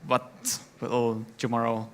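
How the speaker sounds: normal talking voice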